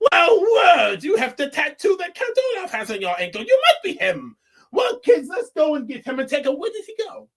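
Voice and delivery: put-on high, loud shouting kids; hammed-up shout of outraged children; mock kids yelling. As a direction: yell it out like frantic kids.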